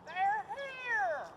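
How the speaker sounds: high sudden cry; excited alarm. Acted. Player spotting arrivals, high excited cry. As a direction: blurt it out in a rush.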